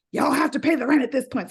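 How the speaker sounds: laying down the law; bossy demand. Retold or imitated. To her roommates about rent, bossy. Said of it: "And I'm like".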